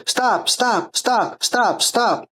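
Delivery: soft, high, pleading repeated stop. Retold or imitated. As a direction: beg the kid to stop without authority. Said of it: "the tone of voice works on them".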